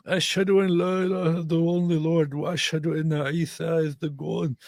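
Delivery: high, chant-like mock creed; mock recitation. Streamer parodying a declaration of faith, mock recitation. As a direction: recite it high in a put-on devout voice.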